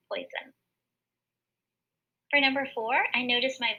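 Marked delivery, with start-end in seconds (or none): 0.1-0.5 poison said much softer; soft repeat. Shown as a demonstration. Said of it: "we'll know that it says oi".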